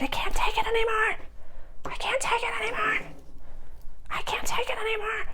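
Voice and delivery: high, soft whispered cry of despair; hammed-up breathless despair. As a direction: whisper it like someone at the end of their rope. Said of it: "and you're like".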